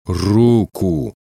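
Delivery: drawn-out, insistent order; commanding. A narrator's reading. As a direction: repeat the order firmly, stretching the word. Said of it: "скомандовал снова Герман". (настойчивый, протяжный приказ)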